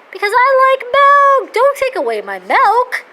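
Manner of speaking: high, loud, indignant protest that slows at the end. Retold or imitated. Answering a nut-milk fan with a high, loud protest. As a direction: defend your milk like a child. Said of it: "I says".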